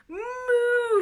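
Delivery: very high, loud mooing calf. Acted. Calf mooing at the frightened animals, high and loud. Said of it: "said the calf loudly again".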